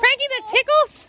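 high, loud, giggly protest; being tickled. Real, not acted. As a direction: squeal at the dog while tickled. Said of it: "The dog is tickling me".

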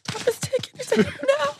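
high, breathy mock emotion, some of it whispered; hammed-up teary acceptance speech; mock tearful speech. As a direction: pretend to be overcome at the award. Pretend tearful award speech.

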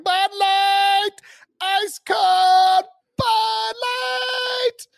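high, slow drawn-out vendor shout; stadium vendor hawking. Retold or imitated. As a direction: belt it like a beer vendor in the stands. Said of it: "hear a beer vendor yelling".

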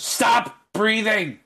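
high, loud, slow stop-breathing yell; exasperated shout. Acted. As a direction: bark it at him in frustration. [name] snaps at Maxim's breathing.

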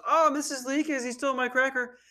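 mock a kid snitching to the teacher; tattling classmate.

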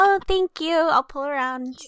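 a hint of sugary politeness; customer service voice. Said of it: "the customer service voice".

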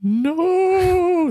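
ghost voice. Acted. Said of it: "that was a ghost".

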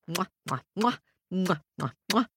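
plain reading voice

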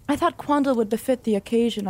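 ordinary delivery

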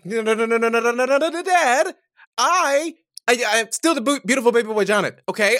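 high, rapid-fire string of denials; frantic protest. Acted. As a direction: plead your case in a rising, flustered voice. Son frantically protests to father.

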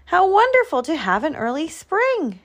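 high, lilting delight; elated animal exclamation. A narrator's reading. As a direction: exclaim it with joyful wonder. Animals rejoice at early spring.

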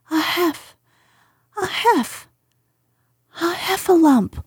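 high, breathless stammer; panting between the words; out of breath. Acted. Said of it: "breathing so hard that he could hardly speak".

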